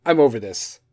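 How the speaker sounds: fed up, falling pitch; stern own voice. Shown as a demonstration. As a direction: say it bluntly, done with it. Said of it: "I'm gonna do it for my voice".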